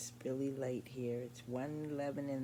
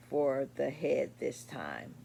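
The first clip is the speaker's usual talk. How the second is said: ordinary delivery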